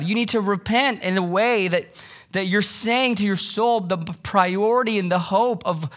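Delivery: usual speaking voice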